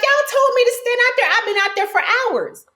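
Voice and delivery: high, fast exasperated protest; exasperated complaint. Retold or imitated. To the producers who kept her waiting, high fast protest. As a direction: vent at them, pitched high and quick. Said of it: "I'm telling the producers".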